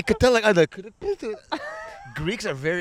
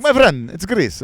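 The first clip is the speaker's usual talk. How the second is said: Greek local; 3 semitones higher, 7 dB louder than usual. Retold or imitated. Imitates a Greek boat worker.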